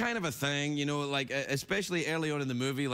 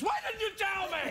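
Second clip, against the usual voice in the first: very high squeaky mock whine; mock squeal; faster than usual. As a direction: squeak it out in fake outrage.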